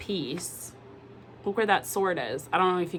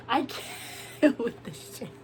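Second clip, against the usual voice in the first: slow, breathy laugh through the words; slow, laughing surrender; laughing give-up; 4 semitones higher, 4 dB quieter, slower than usual. Retold or imitated. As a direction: collapse into giggles. Client voiced collapsing into laughter.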